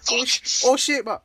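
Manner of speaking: high-pitched mock alarm; gorilla. Retelling the gorilla fight in a high mock-alarmed voice. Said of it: "the gorilla's like".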